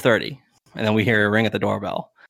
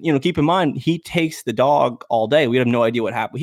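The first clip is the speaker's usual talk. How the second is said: ordinary talk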